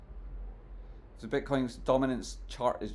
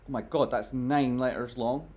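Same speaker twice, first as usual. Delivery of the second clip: usual speaking voice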